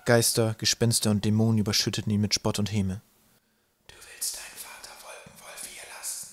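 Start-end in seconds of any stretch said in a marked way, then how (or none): 3.9-6.3 eerie ghostly whisper; barely audible, taunting whisper. A narrator's reading. Ghosts taunting Twig in the Twilight Woods, whispering. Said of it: "wisperten sie".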